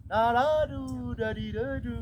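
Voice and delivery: long-drawn, high nonsense-syllable declamation, falling at the end; schoolroom poetry intonation. Shown as a demonstration. Teacher parodying primary-school poetry reading, stretched sing-song. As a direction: chant it like a schoolchild reciting a poem. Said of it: "membaca puisi dengan intonasi".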